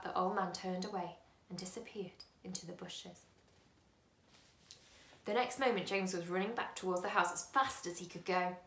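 usual speaking voice

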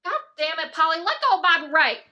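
loud, high, exasperated holler; high-pitched cussing out from afar; yelled scolding. Acted. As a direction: shout at a misbehaving pet to stop. Yells at pet python choking coworker.

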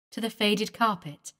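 ordinary delivery

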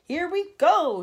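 high, loud shout; excited grasshopper shout. A narrator's reading. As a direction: yell it bouncing with excitement. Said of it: "shouted the old green grasshopper".

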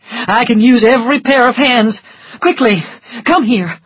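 urgent doctor. A narrator's reading. Doctor summoning Scarlett, urgent and loud. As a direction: call her over fast.

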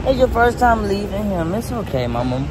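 reassuring his wife at the airport, gently; tender reassurance. Real, not acted. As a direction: comfort her as she leaves. Comforting wife at drop-off.

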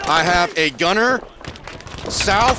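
high, loud callout, slowly; urgent shout. Real, not acted. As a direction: call the enemy position over the noise. Enemy callout in firefight.

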